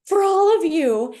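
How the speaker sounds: a bright, teasing sign-off to the audience; playful flourish. Real, not acted. Playful close of her commercial.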